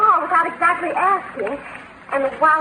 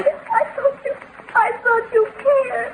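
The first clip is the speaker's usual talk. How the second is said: quietly hurt; 4 semitones higher than usual. Acted. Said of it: "Oh, don't cry".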